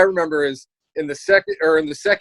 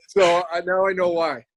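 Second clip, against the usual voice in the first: ordinary delivery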